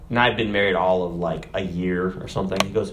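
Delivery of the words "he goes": normal talking voice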